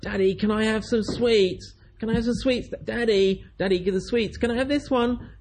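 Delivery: put-on whiny child. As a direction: pester daddy for sweets like a small child. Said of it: "tug on your mum or dad and say".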